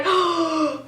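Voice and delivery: sharp acted intake of breath; shocked gasp. Idol spotting his spelling mistake, mock shocked gasp.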